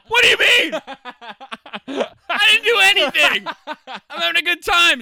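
high, loud, laughing mock innocence; innocent protest. Sean protesting to the dweeb, high and laughing. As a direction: act baffled and carefree. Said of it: "And Sean's like".

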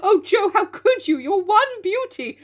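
high, hammed-up wailing over the hair; mock grief. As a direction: lament it, over the top and sobbing. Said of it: "general outbursts of".

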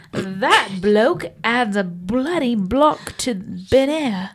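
slow, exaggerated British accent; attempting a British accent for a drinking game. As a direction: drawl it like a proper Brit. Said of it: "okay, I'll do it".

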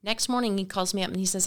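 ordinary talk

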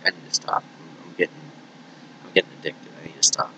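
murmured, nearly voiceless worry; half-whispered self talk. Retold or imitated. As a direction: mutter to yourself about addiction. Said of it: "I was like".

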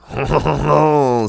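high, loud French "hon hon" laugh; cartoon Frenchman's chortle; exaggerated nasal-vowel French laugh; mock French laugh. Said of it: "do they ever even make that noise".

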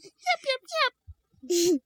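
very high tiny yips; cartoon puppy chirping; squeaky puppy voice. A narrator's reading. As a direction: yip like an excited little puppy. Puppy answers the children.

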